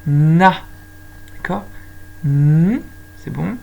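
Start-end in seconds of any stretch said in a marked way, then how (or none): 0.1-0.6 "na" loud with a lengthened n; "na" said as nnn-a, loudly; sounding out syllable. Shown as a demonstration. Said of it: "On entend bien". 2.2-2.8 "N" as a loud hummed nnn; "N" hummed, not named; letter sound. Shown as a demonstration. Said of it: "On entend bien".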